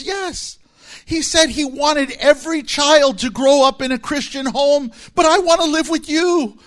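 high, loud mock wail of a child; upset little boy. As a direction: protest like a distressed kid, hammed up. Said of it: "and he's just crying".

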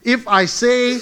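loud, ringing preacher's reading of the psalm; Psalm read out loud and slowing at the end, like a pulpit call; full-force scripture reading. Acted. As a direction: proclaim it to the back row.

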